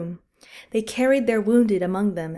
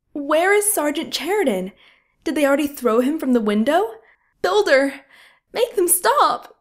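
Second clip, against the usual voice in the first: higher and higher, desperate call; begging someone to make it stop; panicked pleading; 6 semitones higher, 4 dB louder than usual. Acted. Nurse pleading for the killing to stop, panicked high call.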